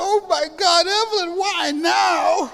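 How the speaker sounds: high, slow, grief-stricken plea; anguished cry. Retold or imitated. A speaker reliving his grief at Evelyn's funeral, anguished. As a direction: cry it out, half-laughing at the memory. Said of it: "So I was crying so hard".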